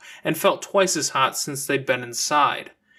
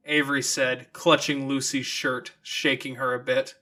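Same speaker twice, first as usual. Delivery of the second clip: ordinary delivery, nothing marked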